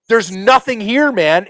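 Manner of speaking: high, loud frustrated outburst; retold exasperated anger. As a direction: re-enact getting madder, voice jumping up. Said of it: "getting madder and madder and madder".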